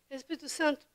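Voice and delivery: normal talking voice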